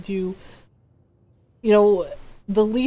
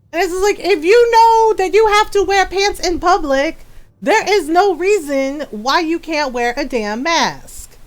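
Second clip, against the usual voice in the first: normal talking voice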